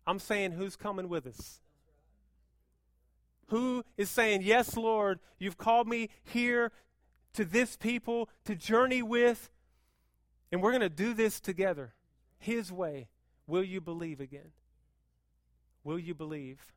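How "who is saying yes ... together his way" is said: loud, high declaration; full-force preaching. Acted. Pastor voicing the church's answer, loud preaching. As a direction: proclaim it with the church.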